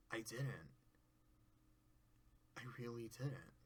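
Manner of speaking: mock Fluttershy's words back at yourself, crushed; defeated hush. Discord admitting he was fooled, soft deflated murmur.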